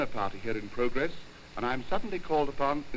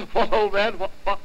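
loud, high startled what; startled nervous man; 7 semitones higher, 6 dB louder than usual. Acted. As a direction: jump as if caught off guard. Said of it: "an exceedingly nervous man".

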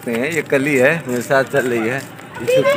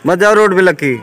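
loud warning call; 7 semitones higher, 7 dB louder than usual. Real, not acted. Warning child off road.

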